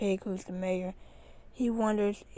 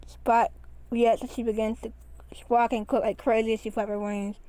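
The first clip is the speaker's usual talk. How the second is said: ordinary delivery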